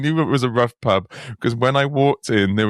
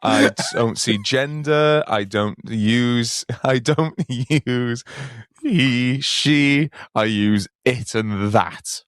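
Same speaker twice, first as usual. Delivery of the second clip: slow, deliberate parody of piety; hammed-up earnestness, savouring each word; mock sanctimonious; much slower than usual. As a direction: spell it out slowly, ever so self-righteous. Mocking an overly inclusive speaker.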